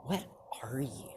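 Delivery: hushed, whispery disbelief; appalled. Acted. Mom seeing Deku's scaly skin, hushed and appalled. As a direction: ask it as if facing a monster. Horrified mom sees a monster.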